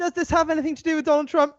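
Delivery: exasperated quote. Retold or imitated. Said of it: "a paragraph in is like".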